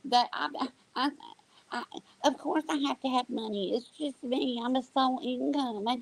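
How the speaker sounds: high, whiny mock excuse. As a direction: parody her self-pitying whine. Mocking the streamer's excuse.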